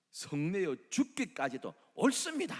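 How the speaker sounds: loud, high, talking back; defiant retort. Acted. Jonah answers God in a loud defiant retort.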